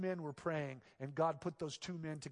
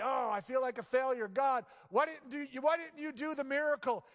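higher, louder anguished complaint to God; pleading complaint; 9 semitones higher, 7 dB louder than usual. Retold or imitated. Pastor voicing his grief at God after a death, pleading. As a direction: ask why like you failed. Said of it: "I might say".

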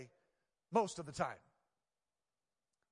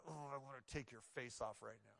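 much softer, lower, suppressed anger with a laugh; inner voice; 4 semitones lower, 11 dB quieter than usual. Retold or imitated. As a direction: say your hidden irritation under your breath. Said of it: "Inside, I'm going".

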